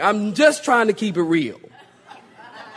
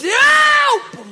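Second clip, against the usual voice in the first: very high, loud comic scream; cry for help; 7 dB louder than usual. Acted. Peter sinking in the waves, hammed-up scream for help. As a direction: stretch it into a hammed-up drowning yell. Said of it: "he's sinking".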